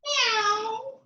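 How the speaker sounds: high, long kitten-like meow; cat meow. Pretend. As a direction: mew high and drawn out. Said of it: "Try your balancing cat again".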